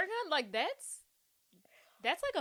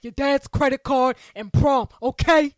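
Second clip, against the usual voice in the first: loud, sneering imitation of John; John impression; 13 dB louder than usual. Quoting John's loud taunt at Claire about her rich life. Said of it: "he sounds just like him".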